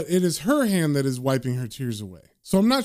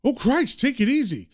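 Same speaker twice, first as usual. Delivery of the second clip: higher-pitched mild alarm; horrified Quincy; 7 semitones higher than usual. Retold or imitated. Horrified man answers the threat, mildly alarmed. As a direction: act lightly horrified, pitch jumping up. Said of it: "a horrified Quincy says".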